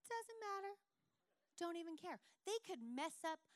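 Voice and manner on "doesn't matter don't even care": carefree. Retold or imitated. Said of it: "I'm like".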